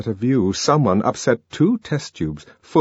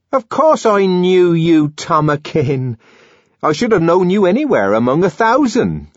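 pleading Linda; 12 semitones higher, 6 dB louder than usual. A narrator's reading. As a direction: beg him to remember you.

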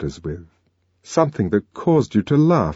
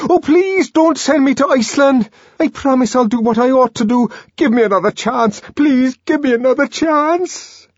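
shrill, loud desperate begging; begging an ex back; 5 dB louder than usual. A narrator's reading. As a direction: grovel and plead, near tears. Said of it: "The tears began to flow".

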